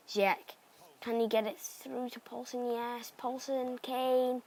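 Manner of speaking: excited commentary. Real, not acted. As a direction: call it like a live goal chance. Live commentary build-up on attack.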